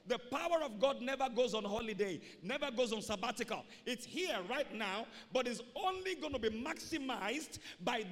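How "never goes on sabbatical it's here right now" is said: ordinary talk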